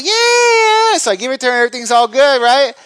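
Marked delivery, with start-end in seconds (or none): 0.0-1.0 very high squealed yeah; kid's cry. Retold or imitated. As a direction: squeal yeah like a delighted kid. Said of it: "she's like".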